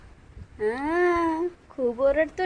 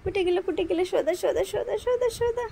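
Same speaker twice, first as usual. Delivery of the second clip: put-on higher-pitched, repetitive baby-talk chant; playful urgent searching; 5 semitones higher than usual. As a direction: turn the hunt into a game, pitch climbing. Playfully hunting a mosquito with baby. (Khelkar लगबगीच्या Surat)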